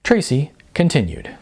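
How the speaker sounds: ordinary delivery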